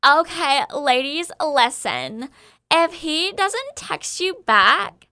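put-on Instagram girl voice. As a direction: lecture the ladies like an Instagram influencer. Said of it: "in my best Instagram girl vo-, voice, here we go".

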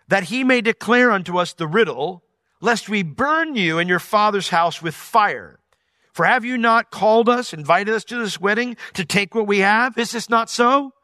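rising menace ending in a shouted question; angry threat. Acted. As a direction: threaten her like an angry mob. Countrymen threaten Samson's wife.

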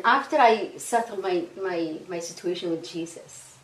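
ordinary talk, nothing marked

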